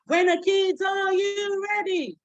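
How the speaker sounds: high, drawn-out call; teacher call. Shown as a demonstration. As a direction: call out to the class. Call-and-response repeated.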